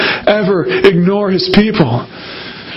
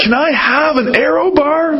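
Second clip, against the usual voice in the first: put-on begging child; 5 semitones higher than usual. Kids begging at checkout.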